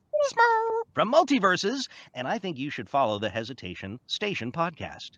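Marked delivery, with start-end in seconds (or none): 0.1-1.9 very high little creature voice. Shown as a demonstration. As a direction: coo it like a tiny cartoon critter. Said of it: "one of the voices of".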